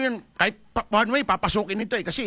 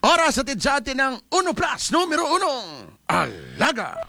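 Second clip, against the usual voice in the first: station time check, a bit higher and brighter in pitch; lightly hammed radio station ID; radio announcer read; 5 semitones higher than usual. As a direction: announce the hour like a jingle tag.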